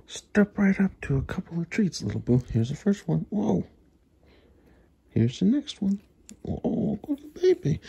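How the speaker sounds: excited treat time. Real, not acted. Handing treats to the pet.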